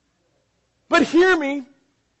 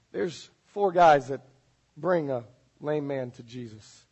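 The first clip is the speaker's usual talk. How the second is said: ordinary talk, nothing marked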